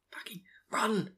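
whispered plea at the character; urgent whisper. Real, not acted. Listener urging Rand to run, urgent whisper. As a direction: hiss it urgently under your breath.

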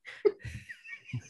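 breathy high laugh; laughing at the memory; high unvoiced laugh. Real, not acted. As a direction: burst into laughter. Laugh after shocked quote.

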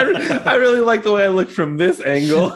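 mock quote broken up by laughter; amused pretend remark, laughing; laughing quip. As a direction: say it through giggles as the joke lands. Co-host voices the woman, laughing.

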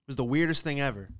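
ordinary talk, nothing marked

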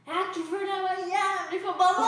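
insisting to the taxi driver, angry shout; retold angry shout. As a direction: blurt it out, furious and drunk.

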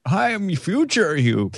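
high old man's voice, put on; wizened old Ayaz. Guest playing the host's future self greets him as an old man. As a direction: croak it out like an ancient version of him. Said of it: "I imagined a wizened old Ayaz with a stick".

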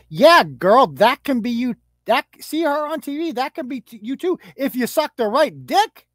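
sarcastic hype voice; mock cheerleading. Mock encouraging the little girl.

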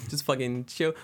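usual speaking voice

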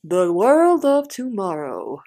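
slow, grand theme-park announcer; promotional voiceover. Retold or imitated. Host likening the tone to Epcot, in slow announcer voice. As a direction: announce it like an exhibit narration. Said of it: "you know what I sound like".

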